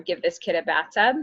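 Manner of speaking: ordinary talk, nothing marked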